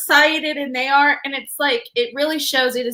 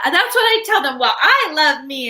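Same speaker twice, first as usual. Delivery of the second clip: higher-pitched, sassy retelling; 4 semitones higher, 3 dB louder than usual. Retold or imitated. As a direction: imitate a little girl getting all sassy. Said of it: "she gets all sassy".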